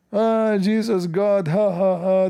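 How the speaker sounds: high-pitched imitation of mockers; mocking jeer. Imitating those who make fun of believers, jeering. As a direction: jeer with a fake, drawn-out laugh. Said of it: "they make fun of you".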